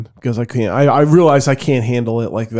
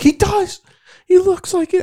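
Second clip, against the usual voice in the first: high squeaky voice. Retold or imitated. Laughing disbelief at evil professor.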